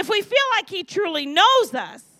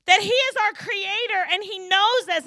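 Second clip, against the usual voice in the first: ordinary talk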